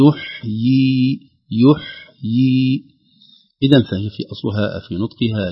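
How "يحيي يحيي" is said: يحيي drawn out with the yaa vowel dropped; wrong pausal form. Shown as a demonstration. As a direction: drawl يحيي very slowly, the way not to pause on it. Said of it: "لو وقفنا لا نقول". (وقف خاطئ على يحيي بتمطيط)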